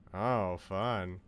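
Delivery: ordinary talk, nothing marked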